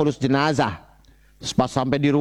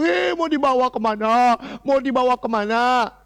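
mock corpse cry; 5 semitones higher than usual. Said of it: "Teriak-teriakan orang itu".

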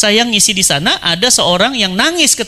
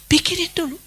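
pikirin dulu lifted high and softer; high repeat; 7 semitones higher, 5 dB quieter than usual. Shown as a demonstration. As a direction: repeat it higher and lighter.

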